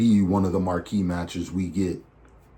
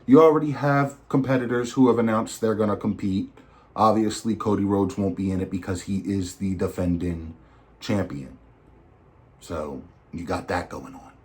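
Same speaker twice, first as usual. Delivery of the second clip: normal talking voice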